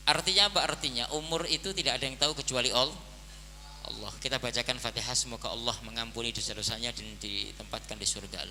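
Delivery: normal talking voice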